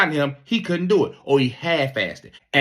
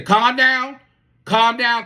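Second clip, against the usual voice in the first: calling out; 10 semitones higher, 4 dB louder, slower than usual. Retold or imitated. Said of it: "they say".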